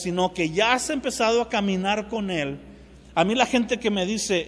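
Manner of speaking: ordinary talk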